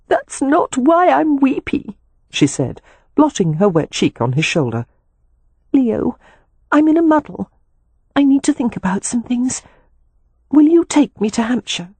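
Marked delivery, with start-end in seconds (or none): 0.1-2.0 put-on high, slow young woman's voice; weepy sister. A narrator's reading. Tearful sister corrects her brother, mildly weepy. 5.7-11.9 high-pitched, pleading woman's voice; troubled sister. A narrator's reading. Upset sister asks brother for help, troubled.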